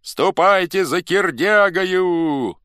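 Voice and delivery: high, very loud, drawn-out call; shouted order. A narrator's reading. Crowd sends men to fetch new chief, shouting. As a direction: send them off with a shout. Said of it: "закричали".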